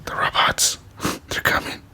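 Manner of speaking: whispered warning, played for fun; mock ominous whisper. Player warning viewers about the robots, joking whisper. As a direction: whisper it like a conspiracy.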